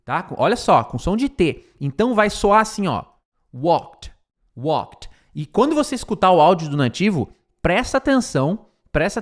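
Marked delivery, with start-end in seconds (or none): 3.5-5.1 "Walked" as one syllable ending in a crisp T. Shown as a demonstration. Teacher showing how it sounds, T ending on "Walked". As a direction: say "Walked" like "wokt". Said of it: "você vai falar com som de T".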